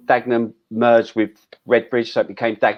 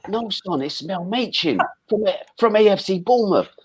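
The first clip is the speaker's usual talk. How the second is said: imitating a Northern manager, pitched higher; mock Northern boss saying son; 9 semitones higher than usual. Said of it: "And he went, he went, he went".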